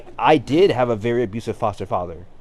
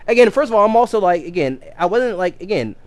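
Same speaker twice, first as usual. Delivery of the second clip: ordinary talk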